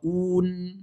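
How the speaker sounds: Un as held "ouun", N unreleased; long Un without an echo; clean final noun. Shown as a demonstration. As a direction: sustain Un and rest on the N. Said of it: "Je prononce le noun".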